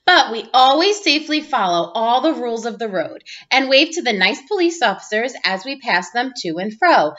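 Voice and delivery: usual speaking voice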